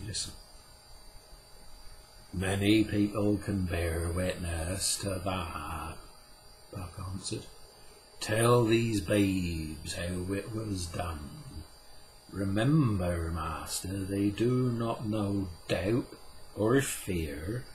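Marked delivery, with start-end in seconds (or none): 2.3-6.0 Puck, slow and solemn. Acted. Puck's voice in the story. 8.2-17.6 Puck, slow and solemn. Acted. Puck's voice in the story.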